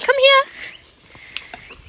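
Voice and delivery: high, slow sing-song call to the dog; pet talk. Real, not acted. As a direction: call her over sweetly. Calling the dog over.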